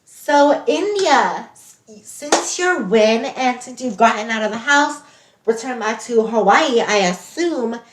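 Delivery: lightly voiced interview host; bubbly talk-show host. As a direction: welcome the guest like a reality show host. Acts as upbeat show host.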